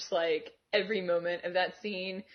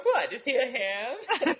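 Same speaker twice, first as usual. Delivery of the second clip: laughing quote; 5 semitones higher, 4 dB louder, slower than usual. Retold or imitated. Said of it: "several great lines".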